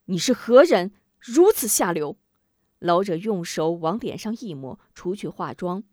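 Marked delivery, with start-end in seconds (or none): 0.0-2.2 loud indignant demand; angry challenge. Acted. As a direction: confront the old man, loud. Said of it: "吴忠质疑老者".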